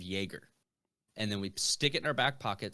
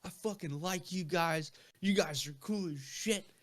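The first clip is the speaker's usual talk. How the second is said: put-on drunk sailor; 6 semitones higher, slower than usual. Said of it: "he was like".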